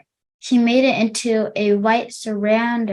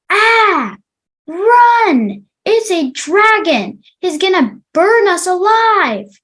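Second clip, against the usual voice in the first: high, loud panicked yell; yelling young boy; 9 semitones higher, 7 dB louder, slower than usual. A narrator's reading. Young boy yells at kids to flee, loud and high. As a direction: scream to the others to run from the dragon. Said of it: "yelled one of the young boys".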